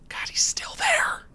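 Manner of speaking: harsh voiceless whisper of dismay; exasperated whisper. Acted. Player seeing he's still there, exasperated whisper. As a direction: whisper it forcefully, without voice. Blocking man has not left.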